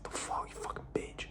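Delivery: imitating a pissed-off guy, cursing under the breath; muttered curse. Ghosted guy at the girl, a muttered, whispered curse. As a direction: hiss the insult in a voiceless whisper. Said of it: "You're like".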